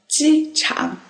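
机场 said slowly with a high level first tone on 机 and a dipping third tone on 场; teacher modelling 机场 with a high level first tone and a dipping third tone; careful tones. Shown as a demonstration. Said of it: "The first tone and the third tone".

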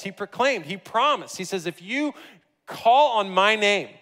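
rising, fervent preacher's proclamation; preaching. Acted. Pastor proclaiming the gospel to the congregation, fervently preached. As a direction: build the promise with conviction.

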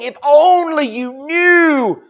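high, slow, emotional aside; Jesus about to raise Lazarus, overcome with emotion; knowing excitement. Acted. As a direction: hold back the joyful secret. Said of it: "Jesus is saying".

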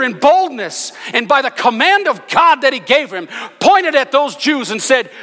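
high, loud, building sermon; full-force preaching. Acted. Preaching Peter's accusation.

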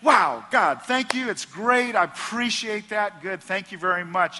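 loud, high, overly bright gratitude; mock gushing thanks. Blind man fakes delight to Jesus, hammed-up gushing thanks. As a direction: gush thanks too loudly, hiding the truth. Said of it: "Suppose he would have said".

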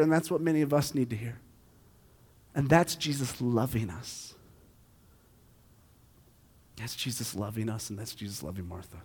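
tender hush. Acted. Pastor closing the point, tenderly hushed. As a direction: let it fall away gently.

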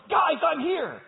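high, loud happy call; eager shout. Pretend. Jesus imagined calling out to the disciples, eager and loud. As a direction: burst in like an eager friend. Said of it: "you'd think he'd run straight".

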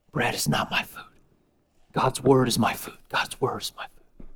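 soft, low, near-whispered resolve; Jesus quietly refusing Satan's bread; quiet firm reply. Acted. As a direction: answer the tempter calmly and quietly.

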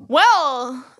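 loud, drawn-out well; yelling. Real, not acted. Co-host defending the point to a heckler, yelling. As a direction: blurt it out loud and long. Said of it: "Why are you yelling".

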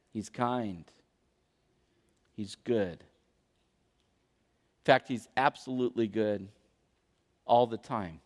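very low, soft, with long pauses; hushed, reverent dwelling on each phrase; tender awe. Real, not acted. Preacher savors God's kindness, tender and hushed.

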